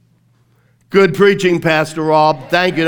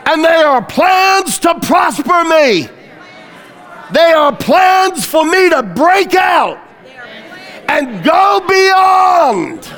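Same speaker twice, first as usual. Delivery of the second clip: loud, high, slow emphatic preaching; fiery pulpit delivery, every line hammered home; preaching at full force; 9 semitones higher, 5 dB louder than usual. Acted. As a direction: preach it out to the back row. Congregation repeats declarations.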